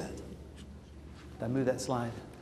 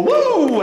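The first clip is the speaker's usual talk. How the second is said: 1 whoop; 6 dB louder than usual. Shown as a demonstration. Jazz version of the song.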